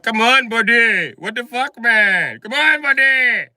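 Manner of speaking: put-on high, loud, slow Indian accent pleading with a buddy; exaggerated Indian accent, loud and drawn out. India telling the US to stop, in a loud, high Indian accent. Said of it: "Even India was like".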